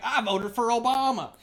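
put-on Southern accent. Feeding a friend a line, high Southern accent. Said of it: "Say something that a Southerner wouldn't say".

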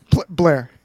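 stammering Blair, punched loud; Chuck Bass voice. Acted. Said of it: "I will be Chuck then".

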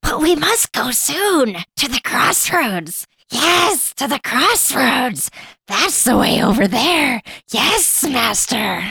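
high, slow, rasping creature voice; Gollum. Acted. Guiding the master to the crossroads, high rasping creature voice. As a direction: stretch each phrase out like an eager servant. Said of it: "Let's all pick a Gollum line".

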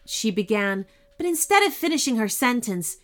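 usual speaking voice

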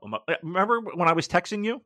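ordinary delivery

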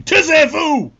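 high, loud re-enacted yell in French; loud shout. Player turning on laughing French kids, loud shout. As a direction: shout it over your shoulder to silence them. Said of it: "turning round loudly and shouting".

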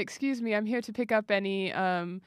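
soft, polite British butler; British butler accent. Acted. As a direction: discreet servant in a British accent. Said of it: "All butlers have British accents".

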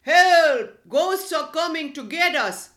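loud, high panicked cry for help; fearful shout. A narrator's reading. Burglars fleeing the noisy house, terrified shout. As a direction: yell in terror. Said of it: "shouting in fear".

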